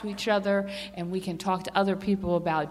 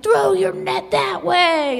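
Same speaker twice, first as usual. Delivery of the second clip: slow, drawn-out call across the water, higher and louder; a stranger hollering a tip to fishermen, stretched and loud; 9 semitones higher, 7 dB louder, much slower than usual. Retold or imitated. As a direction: shout it from the beach to the boat. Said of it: "the guy from the beach yells at him".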